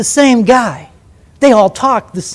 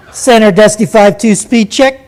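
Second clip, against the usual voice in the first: loud, slick radio call; slick Navy pilot; 6 dB louder than usual. Retold or imitated. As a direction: toss it off like a slick fighter pilot. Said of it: "he talked really slick on the radio".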